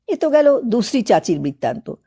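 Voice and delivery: plain reading voice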